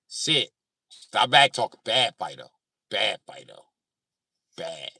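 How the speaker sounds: loud, firm commands as to a dog; mock dog scolding. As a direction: scold a misbehaving dog, loudly. Scolding imagined man like a dog.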